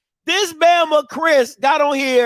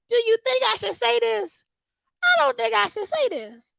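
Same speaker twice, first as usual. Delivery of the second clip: high, soft mock-timid voice; small, high voice of someone unsure; doubtful inner voice; 9 semitones higher, 5 dB quieter than usual. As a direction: act out timid second-guessing, high and soft. Voicing someone's doubt before posting.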